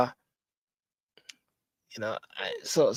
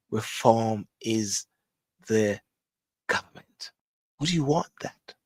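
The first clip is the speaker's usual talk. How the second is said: ordinary talk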